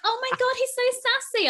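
very high, loud fangirl imitation; mock gushing fans. Host imitates people excusing the driver, mock gushing. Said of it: "loads of people are like".

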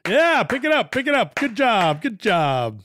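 high, loud, hammed-up cheer from the trailside; cheering manager. Team manager cheering passing skiers, mock loud and high. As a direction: shout encouragement like a peppy team manager. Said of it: "I'd go".

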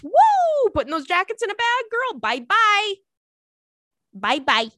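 high, fast, loud gleeful goodbye; excited whoop. Real, not acted. Packing away her winter jackets, gleeful high-pitched bye. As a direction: cheer as the jackets go. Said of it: "packing jackets".

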